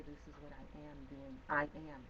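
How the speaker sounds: soft, very slow and deliberate; hushed slow self-affirmation; quiet affirmation. Shown as a demonstration. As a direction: say it low and certain, word by word.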